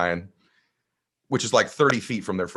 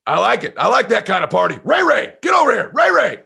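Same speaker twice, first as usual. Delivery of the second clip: loud shouted call, higher; neighbor dad calling; 11 semitones higher, 8 dB louder than usual. Retold or imitated. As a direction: holler for the kid across the house. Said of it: "he goes".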